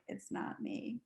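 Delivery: breathy whisper, backing away; hushed mock denial; whispered refusal. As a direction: whisper it like you're dodging the job. Quiet refusal.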